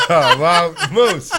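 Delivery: loud laughing exclamation. Real, not acted. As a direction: laugh through the words. Laughing at the ice dump.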